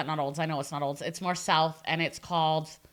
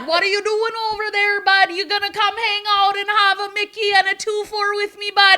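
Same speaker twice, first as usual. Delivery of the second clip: put-on Letterkenny accent; 11 semitones higher, 6 dB louder than usual. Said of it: "Letterkenny accents".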